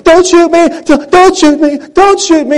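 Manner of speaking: high, loud frantic pleading yell; panicked begging. Retold or imitated. Caught thief pleading with armed homeowner, frantic high yell. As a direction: beg for your life, yelling. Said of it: "He's going".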